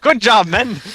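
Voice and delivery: high, hearty call to the troops; mock commendation. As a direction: playfully praise the guards. Calls to passing security guards.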